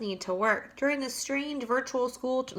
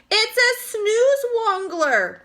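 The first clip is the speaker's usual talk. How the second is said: slow, much higher, loud cry; 11 semitones higher, 5 dB louder, slower than usual. A narrator's reading. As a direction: cry it out in alarm. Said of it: "cried the chief of police".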